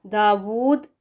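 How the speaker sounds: "दाऊद" as daa-wood with a W; correct W. Shown as a demonstration. Teacher modelling correct "दाऊद" in the Quran. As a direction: say "दाऊद" with clear W. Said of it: "जो डब्ल्यू के साथ है वो correct".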